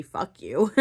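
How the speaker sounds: mock angry curse. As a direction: toss it out like the thing you secretly want to say. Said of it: "I'm wanting to say".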